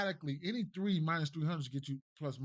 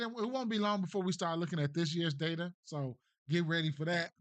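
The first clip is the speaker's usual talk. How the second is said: ordinary talk, nothing marked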